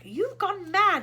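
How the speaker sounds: high, loud angry shout; a loud furious outburst. A narrator's reading. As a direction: yell at him in disbelief. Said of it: "Shuckworth shouted ground control".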